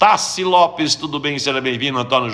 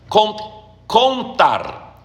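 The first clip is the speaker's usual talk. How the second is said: ordinary talk, nothing marked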